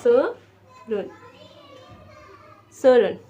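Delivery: slow, drawn-out सोरुन modelled for the class; Korean pronunciation. Shown as a demonstration. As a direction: say सोरुन slowly, syllable by syllable. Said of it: "teacher models Korean number".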